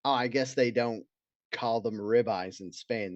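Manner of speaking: usual speaking voice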